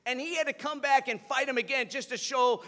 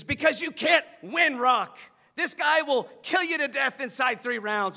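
loud, higher mentor's warning; heated warning; 4 semitones higher, 7 dB louder than usual. Retold or imitated. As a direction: warn your fighter forcefully, loudly. Acted quarrel, Mick warning Rocky.

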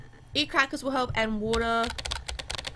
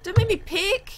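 pleading call; 7 semitones higher, slower than usual. Real, not acted. Begging game not to choose.

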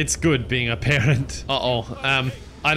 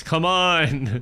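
normal talking voice

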